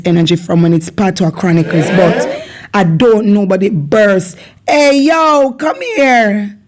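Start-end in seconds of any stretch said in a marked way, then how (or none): 4.7-6.6 calling out. Acted. Scolding someone acting stupid.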